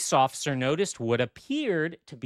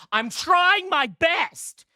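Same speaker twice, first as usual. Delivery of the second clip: whiny mock plea; 12 semitones higher, 6 dB louder, slower than usual. Mock defensive plea about research.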